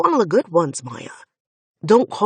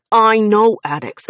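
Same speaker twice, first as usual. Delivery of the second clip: louder, hammered I know addicts; angry echo; 4 semitones higher, 8 dB louder than usual. Acted. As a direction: fling her words back angrily. Finn throws her words back.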